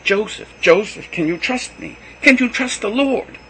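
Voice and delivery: high, loud appeal; pleading to calm him; urgent pleading. A narrator's reading. Mary pleads over his shouting.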